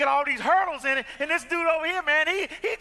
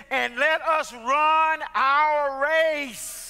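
very slow, weighty; scripture reading; 2 semitones higher, 4 dB louder, much slower than usual. Acted. Pastor quotes Hebrews to the church, slow solemn reading. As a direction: read the verse with weight.

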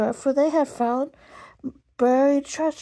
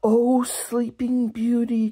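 hammed-up knight; 3 semitones higher, 3 dB louder than usual. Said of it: "Joe cried to Sue".

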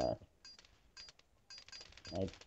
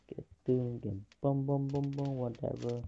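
normal talking voice

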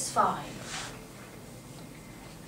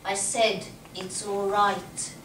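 usual speaking voice

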